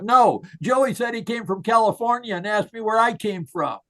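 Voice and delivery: high, eager shouted reply; retold boy shouting his answer; mock child's shout. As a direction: blurt it out like an indignant little boy. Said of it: "And Johnny shouts out".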